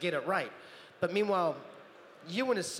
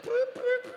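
fart noises; 4 dB louder than usual. Retold or imitated. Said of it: "in the picture's like".

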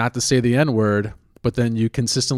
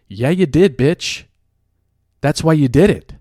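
louder, higher, punchy rebuke; indignant comeback; 5 semitones higher, 7 dB louder than usual. Acted. Telling off the person who cut in front, indignant. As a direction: throw the excuse back at them angrily. Angry comeback to imagined line-cutter.

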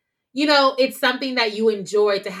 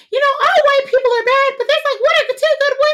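much higher, louder put-on voice; mocking saying; 8 dB louder than usual. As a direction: parrot the cliché in a silly voice. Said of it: "you know how we say".

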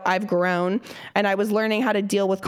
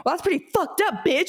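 higher, lightly mocking snap; retold snap; 8 semitones higher than usual. Retold insult to the neighbor, higher and sassy. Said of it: "heard me say".

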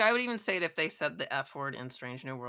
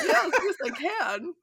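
much higher, louder gleeful yes; eager yes; 8 dB louder, slower than usual. Retold or imitated. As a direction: burst out delighted and keen. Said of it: "Picard's like".

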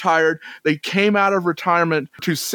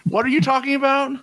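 put-on baffled kid, pitched up high; puzzled kid; 8 semitones higher, faster than usual. Imagined kids questioning an old TV reference, mock bafflement. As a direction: play a young listener who is totally lost. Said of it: "Any kids that may be listening saying".

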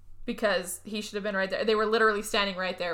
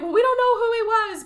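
high, louder put-on girls' voice; mock flustered girls; 12 semitones higher, 5 dB louder than usual. Girls explaining the murder, mock flustered reply. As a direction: protest loudly, pitched way up.

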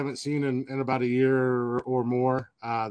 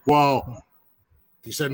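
usual speaking voice

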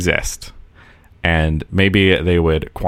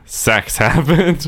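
high, slow deadpan; 10 semitones higher, much slower than usual. Retold or imitated. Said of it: "Mine was literally just the text on the screen".

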